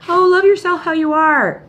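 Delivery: high, mock-sugary coddling; mock pampering voice. Mocking pampering advice-givers, sugary.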